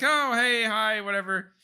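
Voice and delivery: high, bright fake cheer; mock cheery hi. Greeting the landlords with put-on cheer. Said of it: "we're like".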